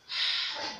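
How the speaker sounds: sighing h sound, audible breath out; breathy h exhale. Shown as a demonstration. Teacher demonstrating the h sound as a sigh for the class. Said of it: "You take in the air. You exhale it out".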